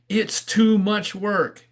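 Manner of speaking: high, slow mock yell; heckling frog's call. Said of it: "what the other frogs are yelling".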